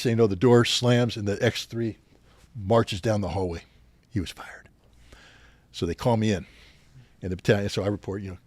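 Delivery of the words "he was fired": whispered, breathy aside about the firing; hushed aside. Real, not acted. Telling the interviewer in a whisper. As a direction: drop to a whisper as if sharing a secret.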